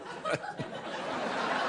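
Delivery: breathy laugh. Real, not acted. Own laugh.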